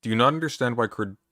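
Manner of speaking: ordinary talk, nothing marked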